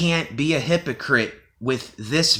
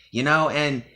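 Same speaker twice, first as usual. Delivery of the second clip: normal talking voice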